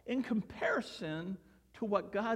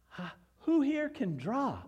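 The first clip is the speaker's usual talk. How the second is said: higher, bright-eyed teacher question; eager question to children; 7 semitones higher than usual. Retold or imitated. Reader asking first graders who can draw, eagerly. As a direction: invite little kids to answer.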